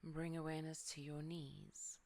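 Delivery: ordinary delivery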